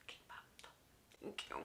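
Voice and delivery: a sheepish whispered thank you after the outburst; embarrassed whisper. Retold or imitated. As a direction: take it back in a whisper. Embarrassed after shouting order.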